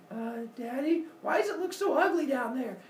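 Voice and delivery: high uneasy question; high timid child voice; worried kid. Retold or imitated. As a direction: ask daddy nervously. Kids worried.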